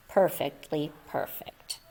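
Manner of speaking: soft satisfied hush. A narrator's reading. Narrator echoes Moose's whisper.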